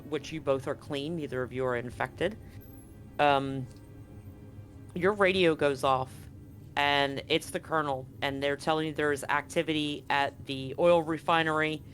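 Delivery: ordinary talk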